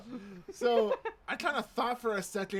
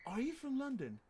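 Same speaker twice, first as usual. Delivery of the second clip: higher-pitched British question; British accent; 6 semitones higher, 3 dB quieter than usual. Retold or imitated. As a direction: imitate a puzzled British customer asking. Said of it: "I can do a decent British accent".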